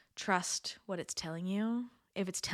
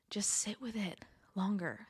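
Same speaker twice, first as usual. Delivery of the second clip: whispering. Real, not acted. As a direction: whisper it close to the mic. Intimate advice, whispered.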